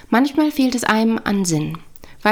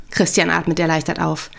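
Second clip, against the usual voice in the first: usual speaking voice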